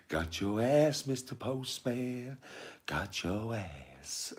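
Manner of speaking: high, drawn-out mock gloating; high taunt sinking into a slow, hushed finish; film dad at the TV. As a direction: stretch it slowly in a high film voice. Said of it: "he's watching that thing on T V and he's like".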